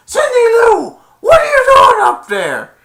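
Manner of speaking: very high, loud calling shout. Retold or imitated. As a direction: yell up to her from below. Grinch calls up to Cindy.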